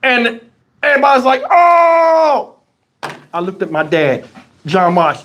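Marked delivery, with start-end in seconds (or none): 1.5-2.6 high, drawn-out mock crowd howl; long raised "ohh". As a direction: imitate onlookers reacting to a missed shot. Crowd reacts to missed shot.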